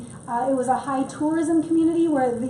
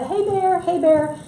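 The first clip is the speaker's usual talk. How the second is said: higher-pitched sing-out call to a bear; 4 semitones higher, slower than usual. Acted. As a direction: call out as if warning bears off. Acts calling out to bears.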